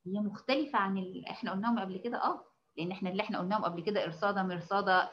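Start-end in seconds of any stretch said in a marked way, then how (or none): none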